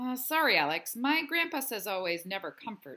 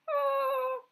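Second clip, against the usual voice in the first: whimper. Acted. Teased kid reacts.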